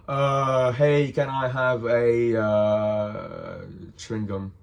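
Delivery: drawn-out, slow, faltering ask; hesitant stalling request. Retold or imitated. Teen asking shopkeeper for cigarettes and losing nerve, hesitant stalling. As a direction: start loud, stretch the fillers, lose nerve and fade. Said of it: "Couldn't even pronounce the word cigarette".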